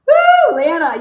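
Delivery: real delighted whoop; whooping laugh. Real, not acted. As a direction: cheer herself on laughing. Laughs at found message.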